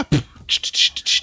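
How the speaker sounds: normal talking voice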